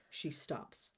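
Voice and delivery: ordinary delivery, nothing marked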